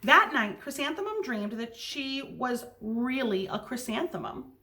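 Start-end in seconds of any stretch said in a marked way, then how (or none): none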